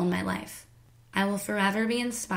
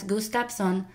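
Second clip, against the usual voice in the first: put-on "Gustafsson" slowly in a Filipino accent, as goos-TAP-sone; Filipino-accented "Gustafsson", f turned to p; slower than usual. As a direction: say "Gustafsson" with Filipino full vowels and a p for the f. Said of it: "with a Filipino accent".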